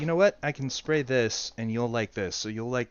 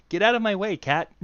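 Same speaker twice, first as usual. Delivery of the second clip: mock bossy; 8 semitones higher, 4 dB louder than usual. Mock bossing the cat.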